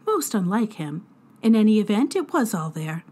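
plain reading voice

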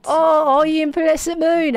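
mock Australian accent. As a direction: echo it in exaggerated Australian. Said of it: "Is that how we're saying the Australian language now?".

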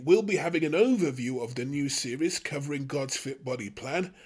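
usual speaking voice

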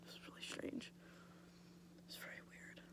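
very soft whisper; whispered aside. Real, not acted. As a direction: drop to a hushed aside.

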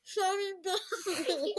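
slow, laughing, mouth packed full; cheeks stuffed full. Real, not acted. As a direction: say it around a mouthful of marshmallows.

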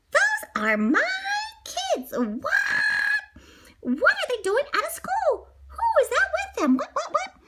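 high, disbelieving cries; shocked teacher. A narrator's reading. Miss Nelson spotting her kids outside, shocked. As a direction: sputter in shock.

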